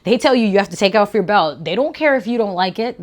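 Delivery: ordinary talk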